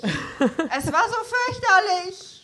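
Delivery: laughing. Real, not acted. Laughing about the climbing park.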